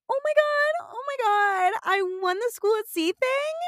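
very high, loud squeal; freaking out. Retold or imitated. Missy hearing she won the cruise, high excited squeal. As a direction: squeal with disbelief. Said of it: "She's freaking out and is like".

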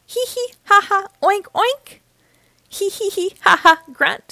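high, put-on piggy giggles and oinks; giggling pig voice. A narrator's reading. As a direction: read out the pigs' laughter in a high little voice. Piglets giggling at story's end.

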